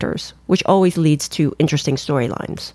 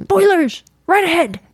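mock lookout's warning cry; 11 semitones higher than usual. Calls out the warning once more.

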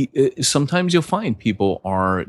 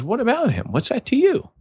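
pointed Jesus retort. Retold or imitated. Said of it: "Jesus goes".

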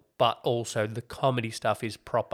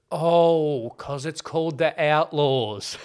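realisation; 3 semitones higher, 5 dB louder, slower than usual. Retold or imitated. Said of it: "and I was like".

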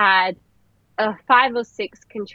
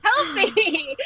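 retold scream; 11 semitones higher, much slower than usual. Telling the husband where to put the towel, a high mock scream. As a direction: squeal it high and drawn out, half-laughing at the memory. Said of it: "I just sort of screamed at him".

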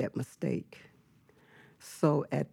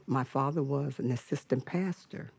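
usual speaking voice

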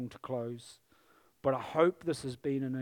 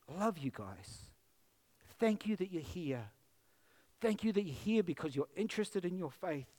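ordinary talk

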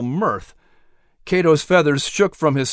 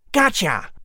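triumphant gotcha; 5 semitones higher than usual. Acted. Squirrel triumphant.

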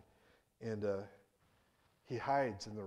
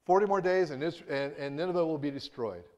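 half-hearted proclamation; 5 semitones higher, 8 dB louder than usual. Retold or imitated. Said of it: "He really put his heart into that, right?".

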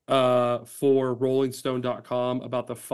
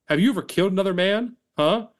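confrontational; 6 semitones higher, 4 dB louder than usual. Acted. Voicing Chris confronting the Star.

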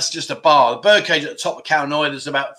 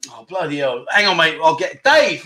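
ordinary delivery

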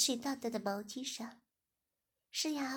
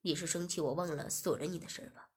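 a lowered, hushed man's voice; low master voice; 6 semitones lower than usual. Acted. Master asking Shiya if she's upset, low hush. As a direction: coax her in a low murmur.

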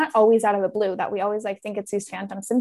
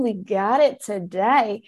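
slow self-talk; much slower than usual. Retold or imitated. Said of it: "And I'm like".

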